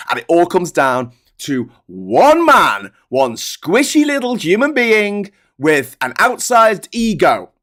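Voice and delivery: slow, high and loud, dragging out each word; building emphatic rant. Real, not acted. Ranting to viewers, building to a loud reveal.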